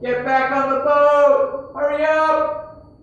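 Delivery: very loud, high, drawn-out shout; yell. Acted. Man on a boat urging a swimmer back, yelling. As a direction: bellow it slowly across the water. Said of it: "he's gonna yell".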